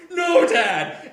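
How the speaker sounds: high, loud cry out to a father; hammed-up yell of protest; mock desperate shout. Acts Clark crying out to father.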